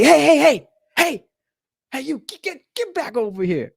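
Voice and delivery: loud high yell of hey; calling out. Retold or imitated. Teacher yelling at a student running for the door. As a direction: shout him back before he reaches the door.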